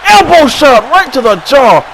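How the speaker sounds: loud ringside yell; excited call. Real, not acted. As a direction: punch out the hit. Big elbow lands.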